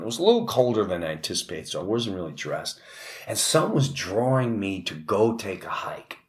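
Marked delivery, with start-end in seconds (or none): 5.4-6.2 hushed, eerie whisper; spooky whispered storytelling. Real, not acted. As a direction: whisper it like a secret pull. Eerie calling to the woods.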